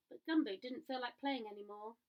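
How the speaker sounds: ordinary delivery, nothing marked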